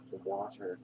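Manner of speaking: ordinary talk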